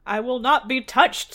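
loud, forceful royal protest; indignant king. A narrator's reading. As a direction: proclaim it loudly as an affronted king. Indignant king's line in the story.

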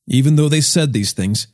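plain reading voice

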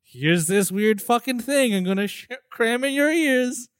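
a haughty conductor, hammed up; mock conductor voice. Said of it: "the conductor is like".

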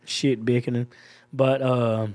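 slow, low Georgia country drawl; laid-back Georgia country talk, unhurried; Georgia accent. Shown as a demonstration. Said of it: "this is my Georgia accent".

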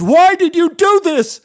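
high, loud furious scream. Acted. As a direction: scream it in his face. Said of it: "He screamed".

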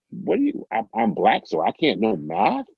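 squeaky high incredulous question; stunned "so I can't know math?"; indignant disbelief. Retold or imitated. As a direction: voice shoots way up in outraged disbelief. Retold indignant reply to prejudice.

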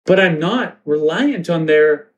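put-on high dazed teen girl. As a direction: wake up high and bewildered. Teen girls waking up.

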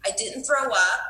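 ordinary talk, nothing marked

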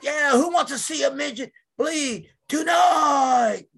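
high, shouted promoter hype; Puppet. Retold or imitated. Boss hyping the show on radio, high shouted pitch. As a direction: whip up the crowd, stretching the last word. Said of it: "it was just like night and day".

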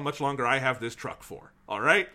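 normal talking voice

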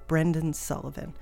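normal talking voice

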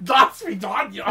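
goofy spaceman farewell; put-on voice breaking into laughter. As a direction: sign off in the silly character voice. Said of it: "Please only talk in the spaceman voice".

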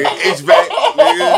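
high, loud excited call; yelling out the answer; excited shout. Real, not acted. Shouting a suggestion, laughing.

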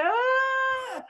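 high, drawn-out imitation wail; mock crying wail. Retelling her breakdown at the retreat, mock crying. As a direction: wail in exaggerated tears. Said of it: "I st-, started crying".

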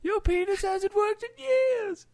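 very high, slow granny voice; hammed-up old dear teasing; mock old lady jab. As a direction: needle him like a cheeky old wife.

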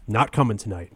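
normal talking voice

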